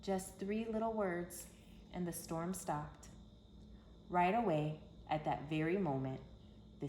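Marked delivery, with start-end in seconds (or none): none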